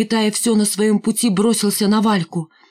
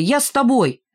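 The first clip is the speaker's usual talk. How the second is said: higher-pitched eager call; calling after someone; 6 semitones higher than usual. A narrator's reading. Лохматый wants to go with Миронова, calling out. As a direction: call out to catch her up. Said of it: "крикнул ей вслед Лохматый".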